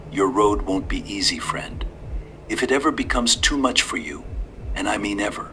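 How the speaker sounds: lightly voiced old man, calm and sly; a hint of a courteous old stranger. A narrator's reading.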